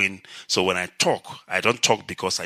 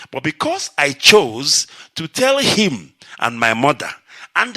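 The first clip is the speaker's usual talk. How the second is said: rising passion; 6 semitones higher, 6 dB louder than usual. Real, not acted. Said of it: "building to a climax".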